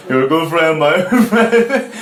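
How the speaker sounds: retold friend's line breaking into real laughter; laughing. Real, not acted. Teller laughs through retold drunk quote.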